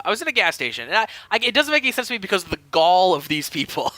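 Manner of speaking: ordinary talk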